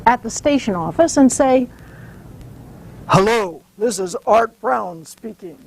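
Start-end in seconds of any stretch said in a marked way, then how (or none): 3.1-5.6 a lightly lowered take on another man's voice, his name stressed; slightly lower, put-on boss's phone voice; Art Brown impression. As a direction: mimic the station boss answering the phone. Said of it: "in a perfect imitation of Art Brown's voice".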